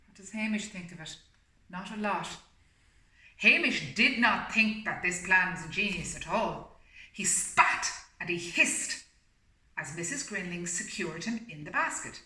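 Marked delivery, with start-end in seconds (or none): 3.4-12.2 steady storybook reading voice; story narration. A narrator's reading. Reading the story aloud to a listening pet, storybook voice. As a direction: read it like a bedtime picture book.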